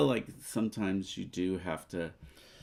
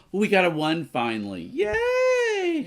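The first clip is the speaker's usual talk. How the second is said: excited; 10 semitones higher, 6 dB louder than usual. Real, not acted. Finally rolled the needed number.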